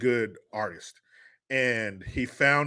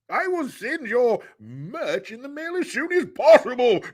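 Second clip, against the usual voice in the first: put-on British lord accent; 12 semitones higher, 3 dB louder than usual. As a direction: play a grand British aristocrat. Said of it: "when I do that voice".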